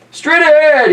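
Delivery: high, loud order to the crew; commanding call. Acted. Albert orders his crew, loud commanding call. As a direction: point and call it out.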